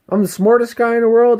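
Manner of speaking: high, loud boasting; puffed-up ego. Acted. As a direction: play the ego bragging at full tilt. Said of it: "When your ego is talking like that".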